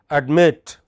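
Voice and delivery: light ad-, strong -MIT in Admit; final-syllable stress. Shown as a demonstration. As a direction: ADMIT with a loud, clear MIT. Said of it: "bring that distinction very clearly".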